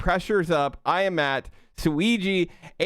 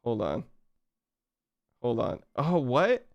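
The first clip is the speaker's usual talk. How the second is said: ordinary talk, nothing marked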